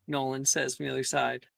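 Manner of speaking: normal talking voice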